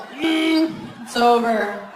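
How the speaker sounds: loud short moan then done; lame fake moan. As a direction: moan once and end it. Repeating the lame orgasm.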